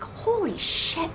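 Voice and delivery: slow, hushed disbelief; stunned whisper. Retold or imitated. Job seeker seeing Paramount Studios address, stunned whisper. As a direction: whisper it slowly in awe.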